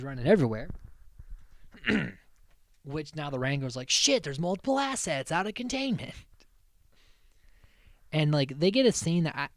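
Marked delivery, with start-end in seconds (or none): 3.9-6.1 higher-pitched retold panic; mock alarm. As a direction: imitate a wrangler raising the alarm. Acts out alarmed wranglers on escape.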